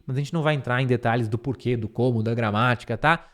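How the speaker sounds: ordinary talk, nothing marked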